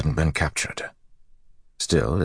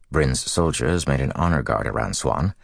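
ordinary delivery, nothing marked

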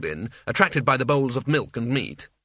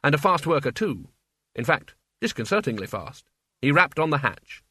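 plain reading voice

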